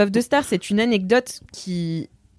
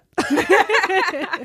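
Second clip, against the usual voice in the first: high laugh; 9 semitones higher than usual. Real, not acted. Laughing at simple question.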